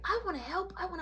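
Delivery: high-pitched mock child plea; begging kid. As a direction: imitate a child pleading to join in. Little kid begs to help.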